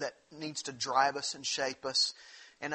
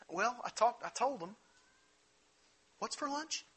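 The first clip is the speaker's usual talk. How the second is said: flippant self; 7 semitones higher than usual. Retold or imitated. Mock flippant self quote.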